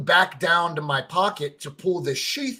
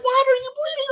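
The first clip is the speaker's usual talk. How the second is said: much higher, alarmed wife voice; imitating his wife; 4 dB louder, faster than usual. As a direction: squeak it out as a worried wife. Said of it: "She's like".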